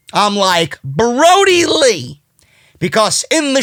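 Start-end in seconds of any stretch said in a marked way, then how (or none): 0.8-2.2 high, drawn-out exclamation; excited recognition. Retold or imitated. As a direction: stretch the name out high in amazement. Acting out his excited recognition.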